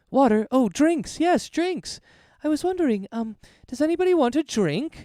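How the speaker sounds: high, excited tavern keeper offering drinks; Errol the eager innkeeper. Acted. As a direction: jump in brightly to rescue the moment. GM voices Errol.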